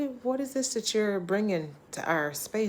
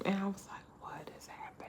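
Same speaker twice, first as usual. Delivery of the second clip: whisper; 3 semitones higher, 13 dB quieter, faster than usual. Real, not acted. Whispered aside about the lady.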